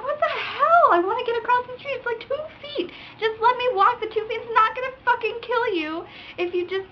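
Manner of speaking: high-pitched, worked-up mock complaint; exasperated retold outburst; retold exasperated rant.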